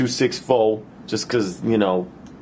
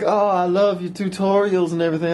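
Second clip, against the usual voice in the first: put-on girl's voice; 7 semitones higher than usual. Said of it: "But she's all like".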